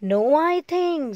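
high, slow loud scream. A narrator's reading. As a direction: bellow it down from the tree. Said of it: "screamed Makra so loudly".

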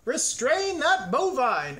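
high, loud, drawn-out order; crying out. A narrator's reading. Museum guard orders the galloping cow stopped, crying out. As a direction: call the alarm at full voice. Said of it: "a guard cried".

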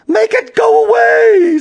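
very high, loud shriek; frantic scream. A narrator's reading. Lady desperate to lose the hair, frantic screaming. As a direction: shriek it in panic, drawn out.

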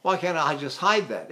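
ordinary talk

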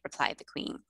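plain reading voice

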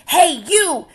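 high, loud shout at a stranger; bully's call. Acted. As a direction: pick a fight from across the room. Grouchy ladybug picks fight.

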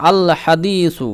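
"আল হাদিসু" very slow, the l clearly held; measured recitation of "আল হাদিসু"; lam fully pronounced. Shown as a demonstration. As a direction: keep the l in "আল", draw out the long i of "হাদিসু". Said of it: "আলিফ লাম ধরে নেব".

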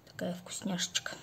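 doting whisper. Real, not acted. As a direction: whisper it like a treat. Doting on favourite plant. (шёпотом)

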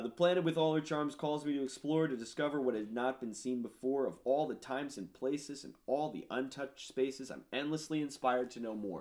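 lyrics half-sung up high; reciting lyrics. Acted. Musician reciting his own lyrics, lilting. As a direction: lift the lyric lines like a song.

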